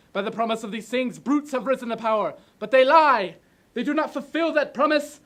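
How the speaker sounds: exploding, loud, high-pitched oration; ranting dictator speech. Acted. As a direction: burst out with everything left. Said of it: "do it like you're just exploding".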